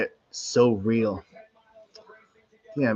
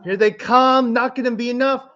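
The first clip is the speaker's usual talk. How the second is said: excited shouting; 12 semitones higher than usual. Real, not acted. Finish line, calling out.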